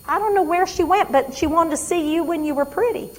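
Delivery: lightly voiced mother, high and bright-pitched; a hint of a confused old mother chatting; chatty old mother. Patient mother chatting to daughter.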